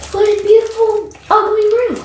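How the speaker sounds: high mock-sweet then "ugly"; mock praise. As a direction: fake delight turning to insult. Mocking the room.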